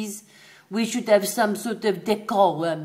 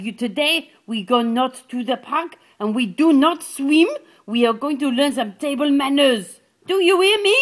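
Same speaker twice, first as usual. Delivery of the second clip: rising mock scolding, louder and higher toward the end; 5 semitones higher, 7 dB louder than usual. Owner scolding the dog about table manners, building louder. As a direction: tell the dog off, building to a shout.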